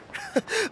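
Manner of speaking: a quick, high delighted chuckle; giddy laugh. Acted. Dancer laughs meeting the Flame Bearer, giddy laugh. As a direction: laugh at your own luck. Lucky day.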